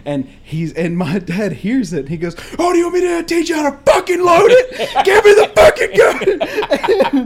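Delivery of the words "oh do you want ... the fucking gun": high, furious screamed demand, re-enacted; hammed-up full-volume rage; raging dad. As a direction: scream it like an enraged, exasperated dad. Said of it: "five-foot-eight rage machine".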